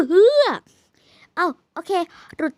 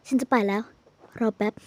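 lower, put-on cool guy voice; posed cool voice; 9 semitones lower than usual. Player putting on a cool voice, teased for it. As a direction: play it cool and low. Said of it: "เก๊กอีกแล้ว เสียงน่ะ".

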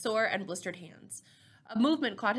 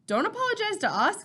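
much higher, louder scolding; sharp rebuke; 12 semitones higher, 5 dB louder than usual. A narrator's reading. Elder rejects the teen's apology, sharp rebuke. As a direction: throw the apology straight back at him.

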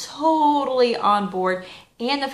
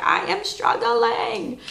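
whiny struggling; 9 semitones higher, much slower than usual. Retold or imitated. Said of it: "I was like".

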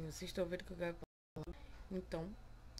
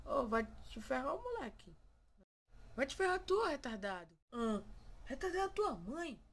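higher, heated mock insults; mock angry quarrel; 5 semitones higher than usual. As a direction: trade insults with the mirror. (briga encenada e exaltada com o espelho)

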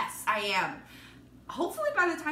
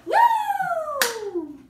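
excited cheer; 9 dB louder than usual. Real, not acted. Celebrating finishing the box.

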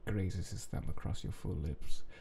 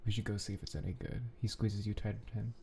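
plain reading voice

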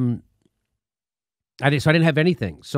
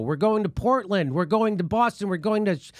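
promo read; 5 semitones higher, 3 dB quieter than usual. Acted. Host plugs tour dates to listeners, announcer ad read.

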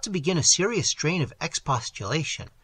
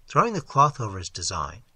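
usual speaking voice

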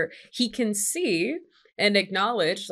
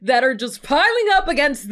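heated; 6 semitones higher, 6 dB louder than usual. Real, not acted. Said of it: "I'm so heated".

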